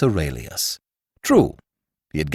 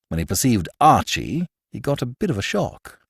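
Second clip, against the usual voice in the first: usual speaking voice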